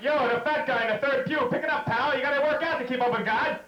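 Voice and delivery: mock trainer yelling at the pew; pushy coach. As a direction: push the fat guy to keep up. Mocking exercise coach voice.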